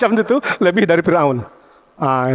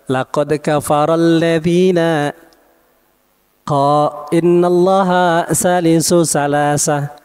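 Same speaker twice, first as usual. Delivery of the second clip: Quran recitation; much slower than usual. Acted. Quran verse recited in sermon.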